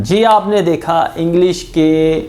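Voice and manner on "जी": ordinary delivery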